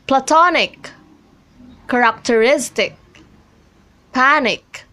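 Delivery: plaTONic, characterISTic, PANic, stress just before the ending; stress before suffix. Shown as a demonstration. Teacher reads examples platonic, characteristic, panic, each stressed before the suffix. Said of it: "on a syllable right before the suffix".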